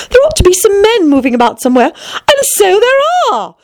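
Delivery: high, delighted exclamation. A narrator's reading. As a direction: let the pitch leap with delight. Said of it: "she added in a tone of delight".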